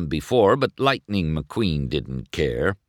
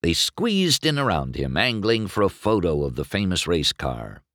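usual speaking voice

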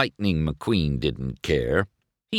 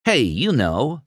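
higher-pitched comeback; shooting back; 8 semitones higher than usual. A narrator's reading. Racer answers his rival, shooting back. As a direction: toss back a retort. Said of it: "McQueen shot back".

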